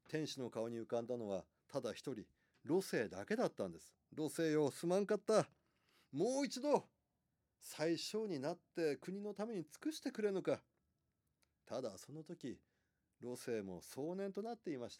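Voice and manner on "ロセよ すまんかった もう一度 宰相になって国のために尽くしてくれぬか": a hint of an old ruler begging, higher; pleading emperor. Emperor begs minister to return.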